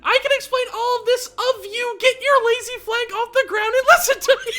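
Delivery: very high, loud cartoon pony voice; bossy pony squeak; Rainbow Dash voice. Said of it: "he saw Rainbow Dash poking him and saying something".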